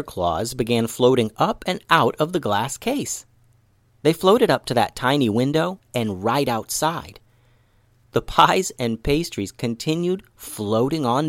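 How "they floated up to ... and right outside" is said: usual speaking voice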